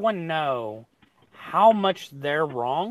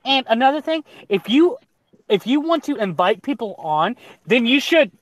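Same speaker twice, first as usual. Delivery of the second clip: loud, high, scolding, cut off mid-sentence; scolding rant; 7 semitones higher, 8 dB louder than usual. Retold or imitated. Streamer retelling Faye's last rant at the host, loud and scolding. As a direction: go off on him. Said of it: "the last thing I heard".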